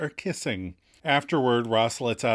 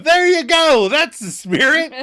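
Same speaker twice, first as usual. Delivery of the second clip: high, loud cheer; mock congratulations; 10 dB louder than usual. Phoebe congratulating her apathetic friend, loud mock cheering. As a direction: whoop it like an over-keen cheerleader. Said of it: "congratulates Monica's apathy with a".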